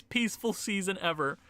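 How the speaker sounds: usual speaking voice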